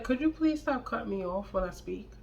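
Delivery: soft, quiet and even, no edge at all; calm request. Retold or imitated. Calmly asking boyfriend to stop cutting her off, soft and steady. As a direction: ask him to stop interrupting, calm and quiet. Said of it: "Just like that".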